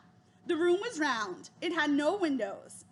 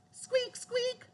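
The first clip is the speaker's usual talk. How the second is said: high, small squeaky voice; mouse squeaking; 9 semitones higher, 3 dB quieter than usual. A narrator's reading.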